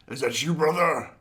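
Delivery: lightly voiced brute asking for his brother; a hint of a gruff villain calling out; Savage Opress voice. Podcast host quoting Savage Opress, lightly voiced villain. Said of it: "Has a very cool voice".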